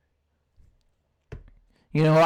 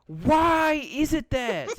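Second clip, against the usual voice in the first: high, slow, stretched-out yell of disbelief; exasperated shout; 9 semitones higher, slower than usual. Real, not acted. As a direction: throw the question up in frustration. Exasperated outburst at friend.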